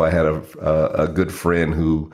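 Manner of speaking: ordinary talk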